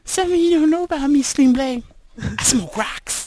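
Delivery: high, loud squeaky mock voice; silly high voice. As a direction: squeal it out like a cartoon. High mock voice to Sling Blade.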